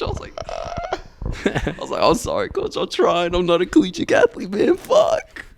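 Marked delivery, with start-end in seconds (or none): 0.5-1.0 squeaky frustrated yelp; high strangled "ah". Retold or imitated. Player squeaks after coach mocks his fumble, high yelp. Retold exasperated cry. 2.0-5.4 higher, pleading whine; whiny apology. Retold or imitated. Player whines an apology to his coach, high and pleading.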